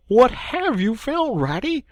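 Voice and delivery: lightly voiced Mole asking; curious Mole. Mole asks Rat what he found, curiously.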